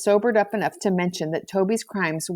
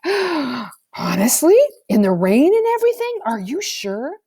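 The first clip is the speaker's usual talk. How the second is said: high, breathless amazement, pitched way up; gasping disbelief; 7 semitones higher, 3 dB louder than usual. A narrator's reading. Girls gasping at Toby's scary story, astonished and high. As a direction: gasp out the questions in shock. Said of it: "The girls gasped".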